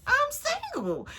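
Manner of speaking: high, drawn-out realization squeal; sing-out high as it hits you; sudden realization. Retold or imitated. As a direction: let it dawn on you, very high and slow. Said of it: "it starts to hit me".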